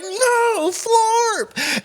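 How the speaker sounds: very high drawn-out cry of horror; mock anguished wail. Acting kids who see their alien friend killed, high hammed-up wail. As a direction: wail it high like a horrified kid.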